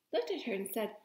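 usual speaking voice

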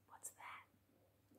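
slow secret whisper. Acted. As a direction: whisper the question. Hushed question to child.

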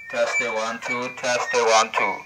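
through a walkie-talkie, loud; radio mic check. Shown as a demonstration.

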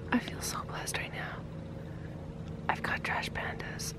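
very soft, real whisper; barely audible excited whisper; awed whisper. Real, not acted. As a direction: whisper it in hushed delight. Hushed beside the raccoons.

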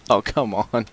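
normal talking voice

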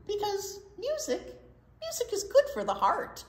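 bright uplift. Acted. Uplifting line in story.

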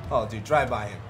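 ordinary talk, nothing marked

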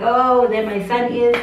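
a light imitation of her father watching his son; imitating dad. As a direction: play the proud father, drawn out.

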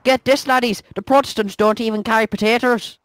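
put-on Irish accent; exaggerated Irish lilt; mock Irish. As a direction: play it in a stage Irish brogue. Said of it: "speaking Irish".

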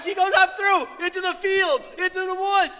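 high, loud, driving sermon voice; preaching at full force. Acted. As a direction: drive the warning home from the pulpit. Preaches the story's lesson.

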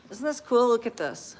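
ordinary talk

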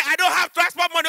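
high, complaining demon voice; lightly voiced Nigerian demon; whining demon. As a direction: plead poverty as the demon. Demon replies.

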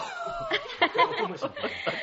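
high burst of laughter; a sudden high delighted laugh; amused laugh. Acted. Detective laughs at the neighbour's nosy spying.

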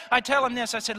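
preaching. Acted. Preaches at full force.

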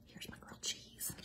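soft unvoiced whisper. Real, not acted. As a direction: hush it to a whisper. Whispering while cooking on camera.

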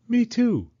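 put-on high, eager child's voice; little girl Penny. Penny asking Mama about snow, high childlike voice. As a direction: ask like an impatient little girl.

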